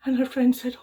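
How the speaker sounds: usual speaking voice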